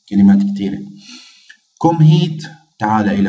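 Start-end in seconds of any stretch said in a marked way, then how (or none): 1.8-2.4 calling out. Shown as a demonstration. Calling someone over.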